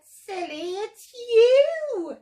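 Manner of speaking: high, loud swoop on YOU; amused teasing. A narrator's reading. Duckbills laughing at Drip's mistake, high teasing call. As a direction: tease with a big drawn-out swoop. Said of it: "they laughed and said".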